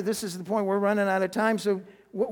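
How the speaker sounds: ordinary talk, nothing marked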